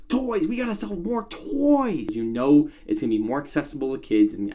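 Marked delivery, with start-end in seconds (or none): 0.1-2.1 much higher, slow, greedy chant; greedy executive voice. Pretend. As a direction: whine for more toy sales. Mocking greedy studio executives.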